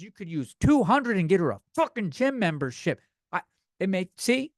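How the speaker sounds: usual speaking voice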